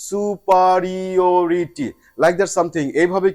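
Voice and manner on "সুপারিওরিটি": সুপারিওরিটি stretched very slowly; careful slow model of সুপারিওরিটি; slow demo word. Shown as a demonstration. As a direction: draw out সুপারিওরিটি for the class. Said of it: "এর antonym word".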